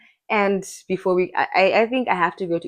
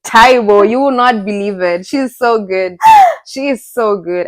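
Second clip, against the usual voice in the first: excited praise; 3 semitones higher, 8 dB louder than usual. Real, not acted. Praising her friend's dancing.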